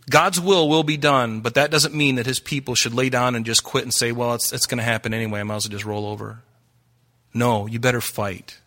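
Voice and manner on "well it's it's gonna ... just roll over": fast, soft, lower shrug of giving up; quiet careless resignation; mock resignation. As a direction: toss it off like you've already quit. Mocking resigned believer.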